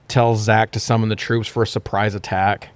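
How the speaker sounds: ordinary delivery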